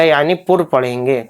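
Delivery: ordinary talk, nothing marked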